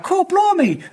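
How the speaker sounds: high, hammed-up Cockney voice; Cockney accent. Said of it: "Why do they speak Cockney?".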